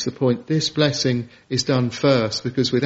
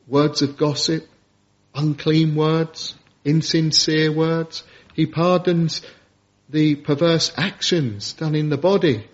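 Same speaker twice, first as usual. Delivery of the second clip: ordinary talk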